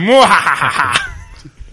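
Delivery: hammed-up villain cackle, muahaha; Vincent Price evil laugh. Mock Vincent Price laugh for friends. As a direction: cackle like a horror-film villain. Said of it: "That's my Vincent Price impression".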